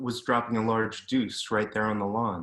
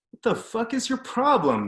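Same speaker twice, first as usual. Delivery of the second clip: much higher, loud outraged shout; angry yell; 12 semitones higher, 4 dB louder than usual. Retold or imitated. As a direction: yell it back, spinning round in shock. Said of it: "the kid yelled".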